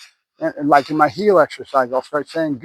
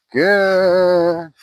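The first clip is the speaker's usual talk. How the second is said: long drawn-out good; steady praise held long; duration marker. Real, not acted. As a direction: stretch the good out slowly. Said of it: "duration marker".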